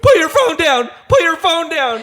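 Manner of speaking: very high, loud frantic screech; hysterical mock shriek. Angela screaming at the cameras, shrill hysterical mock screech. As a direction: shriek it like a meltdown.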